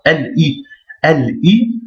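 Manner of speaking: "L I" in two detached pieces; "L I" repeated with a clean break; separated syllables. Shown as a demonstration. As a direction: pause between L and I in "L I". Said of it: "On sépare".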